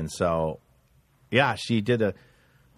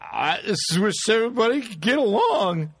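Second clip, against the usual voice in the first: high, halting plea for peace; mock plaintive public appeal; Rodney King; 7 semitones higher than usual. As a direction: stammer the start, then stretch out a pleading line. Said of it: "Rodney King caused such a stir".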